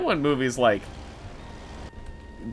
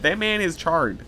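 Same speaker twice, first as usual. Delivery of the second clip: usual speaking voice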